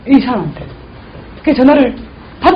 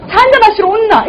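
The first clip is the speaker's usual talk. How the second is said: imitating her invite; 6 semitones higher than usual. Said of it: "이래요".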